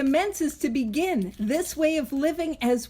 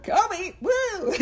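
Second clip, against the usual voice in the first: high playful cheer like a fan; hyped-up little woo; mock cheer; 8 semitones higher, slower than usual. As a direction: cheer yourself on like a sports fan. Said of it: "I'm like".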